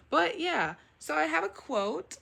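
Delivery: high, loud burst; excited announcement. Real, not acted. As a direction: suddenly perk up to announce. Sudden bright announcement.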